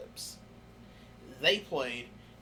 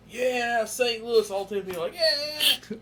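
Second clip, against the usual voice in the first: mock crowd cheer; 8 semitones higher, 9 dB louder, slower than usual. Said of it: "the crowd's like".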